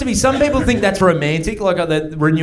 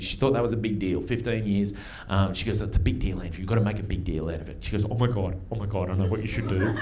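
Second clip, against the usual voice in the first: softer, eager gossip about the anniversary; hushed excited friend; 8 semitones lower, 11 dB quieter than usual. Retold or imitated. Friend quietly urging him to celebrate the anniversary. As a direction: lean in and murmur the big idea.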